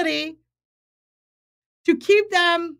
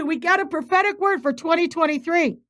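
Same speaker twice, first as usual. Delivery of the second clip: ordinary delivery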